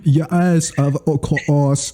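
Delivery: put-on British agreement; British accent. Co-host joining the British bit, British accent. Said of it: "different versions of British accents".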